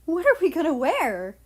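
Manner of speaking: high, loud and breathless with excitement; excited gasp. Acted. Japan panicking to friends about dance outfits, excited. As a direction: blurt it out in a rush of excitement. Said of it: "Japan gasped".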